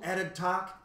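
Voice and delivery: ordinary delivery, nothing marked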